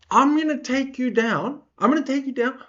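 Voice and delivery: high-pitched first try at an impression; put-on high trash-talk voice; Tom Aspinall. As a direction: raise the pitch for a fighter's threat. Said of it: "sorry, I'll do it in Tom Aspinall's".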